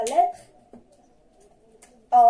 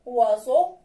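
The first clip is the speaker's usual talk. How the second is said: ordinary talk, nothing marked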